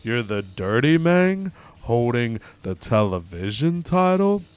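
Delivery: slow cocky promo, dropping the pitch on each Chico; Razor Ramon. Retold or imitated. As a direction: lean back and swagger every line, slowly. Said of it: "Razor Ramon comes out to the ring and he says".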